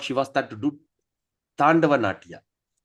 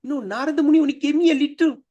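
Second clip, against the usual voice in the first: flustered excuse; 7 semitones higher than usual. Acted. Shiva defends himself to wife.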